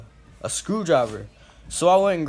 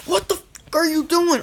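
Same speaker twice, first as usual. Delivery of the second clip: imitating a furious dad, much higher and a bit louder; mad dad; 14 semitones higher, 3 dB louder than usual. Dad running from the truck at his bleeding son, furious. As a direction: yell it as the angry father, hammed up. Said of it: "Like, he was mad".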